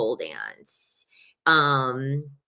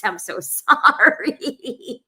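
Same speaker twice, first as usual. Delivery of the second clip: higher, slow, laughing through the sorry; laughing apology; 8 semitones higher, 5 dB louder, much slower than usual. Retold or imitated. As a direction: giggle out a half-hearted apology. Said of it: "that's why I was like".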